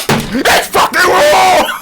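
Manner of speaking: huge, high mock chef scream; explode, stretching the last word out; hammed-up, drawn-out outraged shout; Gordon Ramsay. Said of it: "And he goes".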